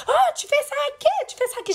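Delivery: very high, squawking mock outrage; shrieky challenge, hammed up; acted confrontation. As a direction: imitate an offended girl picking a fight. Girl stands up to confront her.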